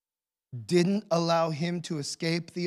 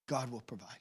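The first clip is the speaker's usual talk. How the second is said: much softer, lower, breaking voice; emotional, hushed; 8 semitones lower, 14 dB quieter, faster than usual. Retold or imitated. Abraham telling his son, quietly emotional. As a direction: barely get the words out. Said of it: "this one is very emotional".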